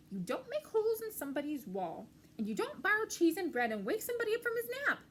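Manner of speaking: high, loud and fast telling off; scolding. Acted. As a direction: rattle off the complaints. Mouse scolding Ratty.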